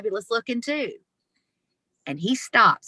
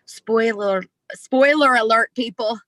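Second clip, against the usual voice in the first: louder and much higher, a teasing announcement; playful call-out; 5 semitones higher, 5 dB louder than usual. Real, not acted. Speaker teasing listeners about heaven and hell, loud playful call. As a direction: ring out the spoiler like a joke. Punchline to audience.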